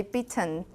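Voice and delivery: bitten with a released, audible T; Taiwanese-style pronunciation. Shown as a demonstration. Teacher shows how Taiwan's speakers say bitten, T released. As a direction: pronounce the T in bitten fully. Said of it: "in Taiwan, will probably just say".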